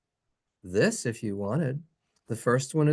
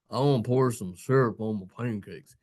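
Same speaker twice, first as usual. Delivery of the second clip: mock silly speaker; 4 semitones lower than usual. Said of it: "To me, it sounds silly".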